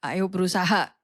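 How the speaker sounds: father comforts his daughter after the test; gentle father. Retold or imitated. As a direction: reassure your crying daughter on the phone. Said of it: "papanya Kak Novi bilang gini".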